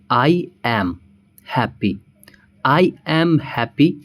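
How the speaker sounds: slow, careful I am happy; full uncontracted form, said slowly. Shown as a demonstration. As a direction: spell out each word slowly. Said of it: "यहाँ पर example से हम शुरू करते हैं".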